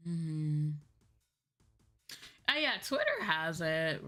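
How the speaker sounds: ordinary talk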